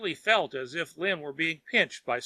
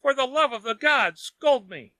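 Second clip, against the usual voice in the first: high and louder, pleading; desperate begging; 9 semitones higher, 5 dB louder than usual. A narrator's reading. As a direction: cry out for mercy. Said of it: "begged Wang".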